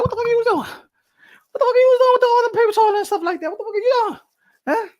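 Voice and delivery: high, loud, rapid mock outrage; hammed-up woman yelling an accusation; imitated scolding woman. Woman confronting him over the paper towels, mock scolding. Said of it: "woman gonna be like".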